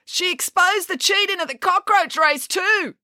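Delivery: high-pitched call from a crowd. A narrator's reading. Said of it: "called out another citizen".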